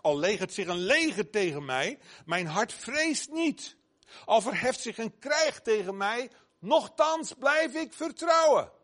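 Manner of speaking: builds to a loud, high climax on vertrouwen; fervent psalm cry. Acted. David's psalm quoted with fervour. (vurig, steeds luider en hoger uitgeroepen)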